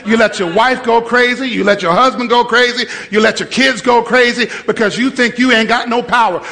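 ordinary talk

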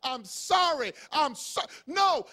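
loud, slow, high emphatic preaching; shouted preaching. Acted. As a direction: shout it at the congregation.

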